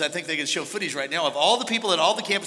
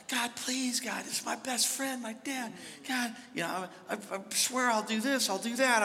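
soft, higher pleading to God; begging God; 5 semitones higher, 8 dB quieter than usual. Retold or imitated. Son pleading with God for his dying dad, soft and pleading. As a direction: plead quietly, a retold prayer. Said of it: "I remember begging God".

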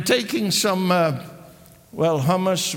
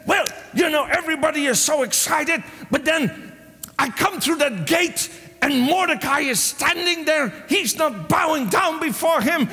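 upset Haman; 7 semitones higher than usual. Retold or imitated. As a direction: whine to his wife that his joy is gone. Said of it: "All his joy is away".

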